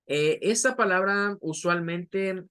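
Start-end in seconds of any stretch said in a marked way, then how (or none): none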